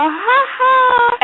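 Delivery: put-on very high, taunting child's laugh; gleeful toddler. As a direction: imitate a naughty little kid crowing. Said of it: "I hear Kyler go".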